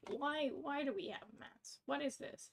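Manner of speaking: ordinary talk, nothing marked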